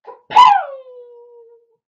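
high, loud, long-held "kapow"; comic-book punch noise. A narrator's reading. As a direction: belt out the impact sound. Hero strikes at the pea.

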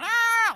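high mock scream from a haunted-house actor; hammed-up haunted-house shriek; mock monster yell. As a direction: imitate a cheap scare yell. Jail actor yells.

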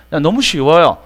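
ordinary delivery

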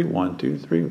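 ordinary talk, nothing marked

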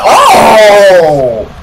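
long, high "oh" shout; loud falling whoop of amazement; excited scream. Real, not acted. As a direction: erupt in a drawn-out yell at the play. Reacting to a big play.

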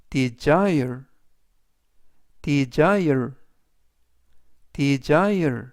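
desire with a voiced z, "di-ZIRE", stress on the second syllable; desire with z sound. Shown as a demonstration. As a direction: say desire with z, not s. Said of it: "스가 아니라 즈".

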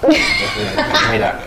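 mock-offer help while laughing; laughing tease. Real, not acted. Husband teases his partner about her helping him, laughing.

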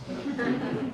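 a quick unvoiced laugh under the breath; soft breathy laugh. Real, not acted. Narrator stifling a breathy laugh mid-story. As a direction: let out a silent huff of a laugh.

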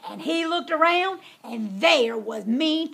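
plain reading voice

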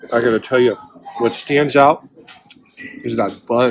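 speaking between bites; talking while chewing. Real, not acted. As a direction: mouth partly full of food. Seen 0: bitten burger in hand, closed-mouth chewing shapes between words.

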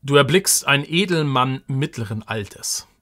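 ordinary talk, nothing marked

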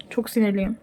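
ordinary talk, nothing marked

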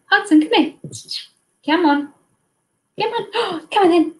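high, short pet-calling phrases; coaxing a dog. Real, not acted. Streamer urging Hudson to come on in, high coaxing.

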